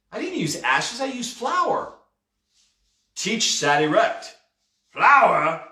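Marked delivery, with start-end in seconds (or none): 0.1-1.9 put-on high-pitched boy protesting his innocence; puzzled exclamation. A narrator's reading. Boy correcting the pirate about the ashes, exclaiming. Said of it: "J D exclaimed". 4.9-5.7 louder, startled one-word echo; gasped question. A narrator's reading. Pirate hearing about the flour, gasping. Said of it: "he gasped".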